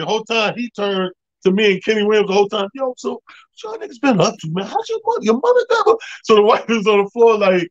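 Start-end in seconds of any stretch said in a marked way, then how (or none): 2.7-6.0 much higher, excited Tracy chatter; a hyped-up Tracy impression, pitched way up; imitating Tracy. Tracy chatting up his friends courtside, high and excited.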